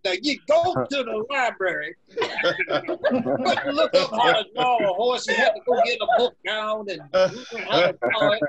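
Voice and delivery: put-on creaky grumpy old man voice. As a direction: lecture like a cranky grandpa. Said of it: "his old man voice like I would".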